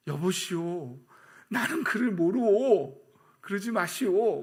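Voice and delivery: loud, high, drawn-out protest; hammed-up, slow, insistent disavowal; Peter's denial. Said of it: "낙심돼 있고 시선도 멀어져 있는 상태예요". (큰 소리로 길게 끌며 과장되게 부인)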